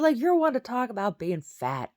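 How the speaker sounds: loud, high-pitched retold retort; indignant comeback. Daughter answers mom's fat insult, loud indignant comeback.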